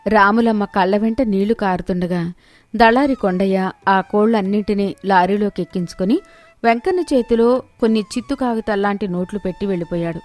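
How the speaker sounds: ordinary delivery, nothing marked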